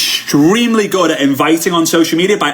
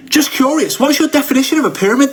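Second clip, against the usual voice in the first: higher, light curious question; demo reply; 9 semitones higher than usual. Shown as a demonstration. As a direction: ask gently curious, no push. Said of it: "So here we go".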